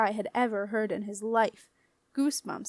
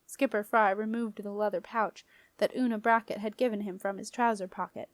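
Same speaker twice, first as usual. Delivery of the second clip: plain reading voice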